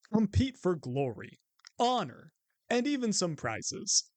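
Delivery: upbeat event promo read; announcer read. Acted. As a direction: sell the tournament like an ad.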